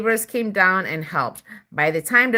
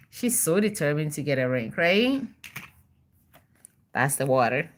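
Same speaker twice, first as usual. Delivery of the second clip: plain reading voice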